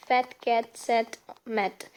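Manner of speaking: "Fat cat sat mat" slowly, each word separately stressed; teacher stressing every word in "Fat cat sat mat"; slow, separate beats on "Fat cat sat mat"; stressed rhyming words. Shown as a demonstration. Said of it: "we stress by, while pronouncing".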